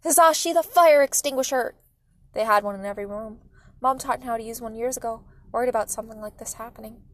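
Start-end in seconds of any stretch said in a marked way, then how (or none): 0.0-1.8 urgent, loud, higher-pitched shout; alarmed shouted order; yelling. A narrator's reading. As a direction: yell for help with the fire. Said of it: "Mom yelled".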